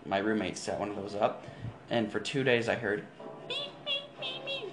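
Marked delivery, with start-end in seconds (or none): 3.5-4.7 high beeping noise; beep imitation. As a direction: imitate the trap's beeps. Imitating rat trap beeping.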